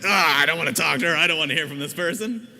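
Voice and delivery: fast, loud, bored brush-off; dismissive brush-off. Retold or imitated. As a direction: wave her off, rushed and loud. Dismissive inner voice acted.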